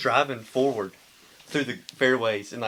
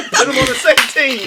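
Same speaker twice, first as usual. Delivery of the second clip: high and loud, laughing through the words; delighted laughing insistence; laughing loud repeat; 13 semitones higher, 10 dB louder than usual. Real, not acted. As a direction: cackle the suggestion again with glee.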